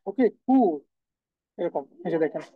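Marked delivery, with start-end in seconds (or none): 0.1-0.6 কু modelled as German Q; German letter name. Shown as a demonstration. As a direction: say কু, a short k plus u. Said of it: "কিউর যে উচ্চারণ সেটা হচ্ছে কু". (German Q-এর নাম কু)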